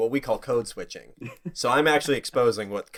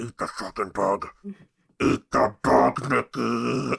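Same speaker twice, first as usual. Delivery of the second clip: creepy little voice; slower than usual. Retold or imitated. Brother's voice in his head.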